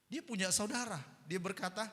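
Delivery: ordinary delivery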